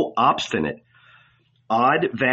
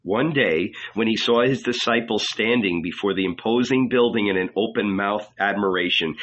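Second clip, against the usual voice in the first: ordinary delivery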